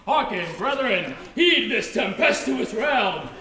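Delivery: lightly voiced pig, slow and loud; pompous pig voice. Pig warns his brothers in a mock-grand, slow voice. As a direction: declaim it like a worried little pig. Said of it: "pig number first spake, saying".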